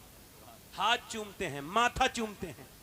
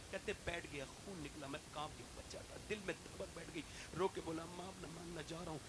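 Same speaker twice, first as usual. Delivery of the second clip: much softer, intimate tale-telling voice; hushed sermon storytelling; 3 semitones lower, 5 dB quieter than usual. Acted. Preacher telling the elder's childhood story, hushed and intimate. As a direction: draw the crowd in with a quiet story.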